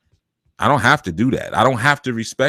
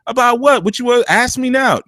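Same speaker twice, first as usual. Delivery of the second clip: much higher, pointed mother's voice; suspicious mother; 13 semitones higher than usual. Retold or imitated. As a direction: mom sniffing out what he's up to. Mother answering son after church.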